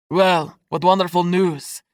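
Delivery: hollowly delivered congratulation; accented uncle, a touch hollow. A narrator's reading. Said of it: "he said hollowly".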